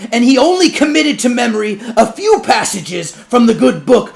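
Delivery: high, loud orator's denunciation; judge's public denunciation. Acted. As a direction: thunder the accusation to the crowd. Judge denounces preacher to crowd.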